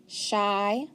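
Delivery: ordinary talk, nothing marked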